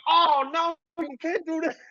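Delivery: high, mock-horrified voice; imitating Devin. Devin reacting to the stitches news, high mock horror. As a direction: play him appalled and laughing. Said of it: "And Devin's like".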